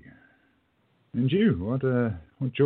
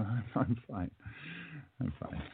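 unconvincing fine; 11 dB quieter than usual. Acted. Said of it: "Are you sick?".

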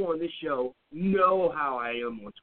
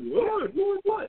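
high-pitched, repeated feigned surprise; mock bewilderment; much slower than usual. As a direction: play innocent, voice jumping way up. Acts out feigned bewilderment at angry reaction.

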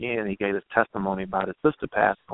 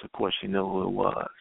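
ordinary talk

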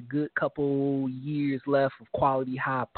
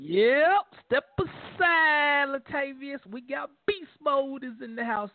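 stretched, higher hype-man call-out; 9 semitones higher, slower than usual. Real, not acted. Echoing the clip to call out Latavius, stretched hype shout. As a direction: drag it out like a stadium announcer.

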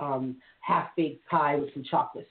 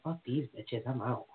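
low, much softer grumble; mock dismissive exit; 6 semitones lower, 8 dB quieter than usual. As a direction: brush them off under your breath. Imagined Chris muttering exit.

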